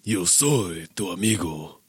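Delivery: slow, lightly done Mexican soap opera accent; a hint of a Mexican telenovela accent. Shown as a demonstration. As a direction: play a telenovela heartthrob, lightly. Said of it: "Mexican soap opera accent".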